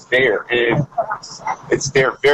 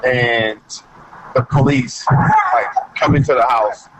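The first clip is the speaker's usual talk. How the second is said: normal talking voice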